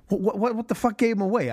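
high, rising panicked disbelief; shocked disbelief. Retold or imitated. Audience member caught off guard, high alarmed disbelief. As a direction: voice jumps up in alarm. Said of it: "you're like".

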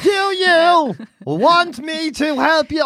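high, slow mock baby-talk; talking to a toddler. Mocking the husband talking down to a foreign friend as if a toddler. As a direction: patronise them like a three-year-old. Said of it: "how you talk to a three-year-old".

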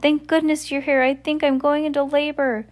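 high-pitched, strained-sounding plea for help; faint cry. A narrator's reading. Kit in labor calls from the raft, crying out. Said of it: "came the faint cry of Kit".

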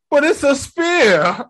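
high, slow, half sobbing; whining at being told to clean; whiny crying Thor. Retold or imitated. Thor voiced in a retold scene.

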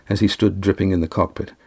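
ordinary delivery, nothing marked

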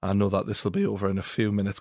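imitated deadpan, low and soft; low, quiet deadpan delivery. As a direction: quote him coolly, unmoved.

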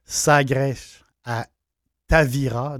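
Sagres with a Portuguese accent, the last s turned to sh; Portuguese-style Sagres, ending "-esh". Shown as a demonstration. Vlogger repeats the town name in his put-on Portuguese accent. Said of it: "je prends mon accent portugais".

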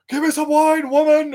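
high, loud hammed-up bellow; mock drunken demand. Grumpy drunk Nick Cage demands wine.

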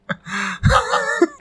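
very high laugh; high squealing laugh. Real, not acted. Finishing the hooker story, squealing with laughter.

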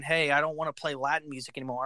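ordinary talk

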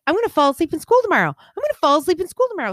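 put-on high, fast, repeated child's worry; frantic kid. Mom mimicking her son's frantic worry, high and rushed. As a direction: rattle it off like a panicked boy. Son fretting about school, voiced.

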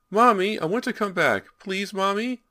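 put-on high, loud child's plea; frightened child's piercing voice; pleading little boy. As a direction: beg for mother like a trapped small boy. Trapped boy begs his mother.

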